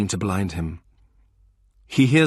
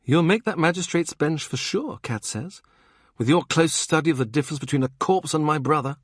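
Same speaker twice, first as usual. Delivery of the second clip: higher sarcastic retort; Kat voiced; 7 semitones higher than usual. Kat mocks Morgan's magistrate ambitions, sarcastic. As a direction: cut him down with sarcasm.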